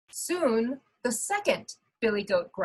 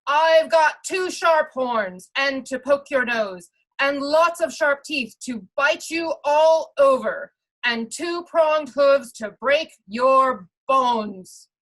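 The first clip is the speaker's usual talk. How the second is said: much louder, defiant threats; big goat's boast; 4 semitones higher, 6 dB louder than usual. Acted. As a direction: warn the troll with a booming boast. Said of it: "And he added".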